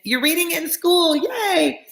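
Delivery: excited high-pitched Target lady impression. Reader cheering a listener reading it in school, Target lady squeal. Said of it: "I'm like the Target lady".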